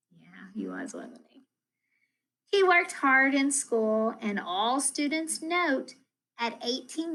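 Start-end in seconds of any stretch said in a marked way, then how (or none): 0.1-1.4 hushed, breathy aside answering herself; soft cooing whisper to the class. Real, not acted. Answers own question softly.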